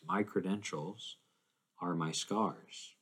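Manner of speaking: soft, weighty delivery, drawn out at the end; hushed solemn climax. Acted. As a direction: let it land quietly, with gravity. Solemn climax after list of sufferings.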